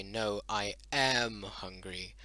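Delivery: slow, with am drawn out and leaned on; No, I am hungry, with weight on am; stress on am. Shown as a demonstration. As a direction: lean hard on am to contradict. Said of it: "enunciate it a lot more".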